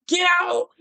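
imitated scream.

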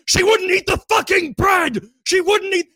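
very high mock shouted complaint; retold screaming. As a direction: imitate a held-back man screaming in protest. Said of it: "screaming like".

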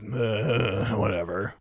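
low, soft grunt of agreement; grudging dismissal. Retold or imitated. Bryce reluctantly taking the demon's deal, dismissive.

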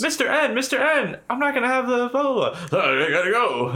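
high, fussy rushing voice; high hurried professor. Retold or imitated. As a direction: play a flustered professor late for class. Imitating a professor hurrying.

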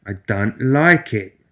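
very slow, steely, menacing. Acted. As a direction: pour it out like midnight. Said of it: "Her voice kept that steely oiled quality".

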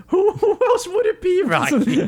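mock disbelief, very high and laughing; incredulous trainer. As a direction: squeal the question through laughter. Mickey's disbelief acted through laughter.

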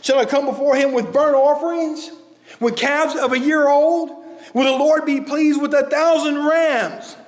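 mock the careless people bargaining with God; flippant. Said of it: "in a very flippant manner".

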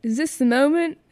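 put-on high, amateur British accent. Reader redoing Harry's dialogue on request, British accent. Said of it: "if I could do a British accent".

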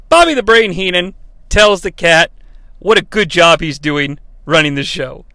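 usual speaking voice

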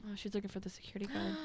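fast hushed whisper. Real, not acted. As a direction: breathe it out tensely. Tense horror scene.

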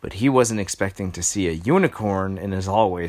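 ordinary delivery

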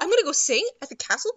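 loud, fast, giddy gush; hammed-up thrilled girl. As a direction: rattle it off in delight. Erica thrilled about the castle.

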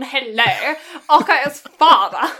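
exaggerated British, punched at the end; overdone British; really British. Real, not acted. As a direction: lay the British accent on thick. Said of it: "I've gone really British now".

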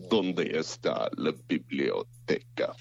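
slow, low language tape voice; like a language course recording. Pretend. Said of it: "sound like a voice recording".

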